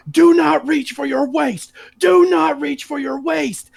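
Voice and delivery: high, slow barked order; mock police shout. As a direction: yell it like a cop with his gun drawn. Cops yelling commands retold.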